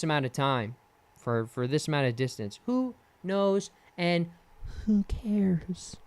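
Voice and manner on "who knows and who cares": a breathy whisper under his breath; whispered aside. Real, not acted. To himself about a bike record, whispered. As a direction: shrug it off in a whisper.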